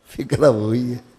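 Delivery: normal talking voice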